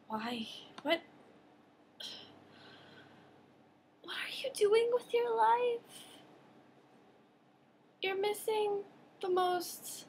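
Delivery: high, strained, incredulous plea; hammed-up anguish at their choices; mock despair. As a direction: play exaggerated disbelief with long pauses.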